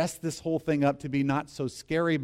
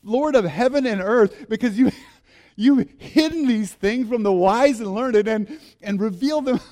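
louder praise broken by laughter; full of joy; 5 semitones higher, 6 dB louder than usual. Acted. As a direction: pray it laughing, bubbling with joy. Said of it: "full of joy".

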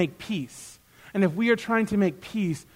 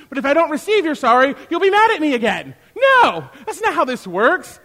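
high, loud, heated insistence; impassioned acted scolding; passionate rebuke; 8 semitones higher, 6 dB louder than usual. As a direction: drive it home, worked up. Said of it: "I'm getting a little passionate".

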